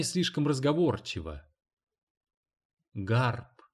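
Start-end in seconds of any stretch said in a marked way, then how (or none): 2.9-3.6 understated curt reply. A narrator's reading. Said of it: "коротко сказала она".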